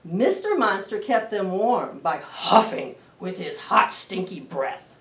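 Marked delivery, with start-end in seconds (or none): none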